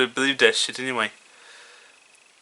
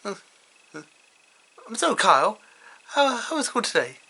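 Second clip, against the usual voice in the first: higher, softer mother voice; lightly voiced mom, pitched up and soft; Sheila; 6 semitones higher, 4 dB quieter than usual. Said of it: "she says".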